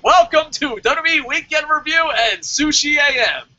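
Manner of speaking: high, projected show-opening shout; mock show announcer. Host jokingly renames the review show after sushi, announcer shout. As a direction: belt out a playful show welcome.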